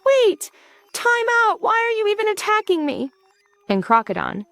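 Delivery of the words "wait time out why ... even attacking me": high-pitched protesting yell. A narrator's reading. Hero halts the charging monster, yelling high in protest. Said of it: "Dalen yells".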